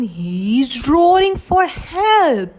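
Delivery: ordinary delivery, nothing marked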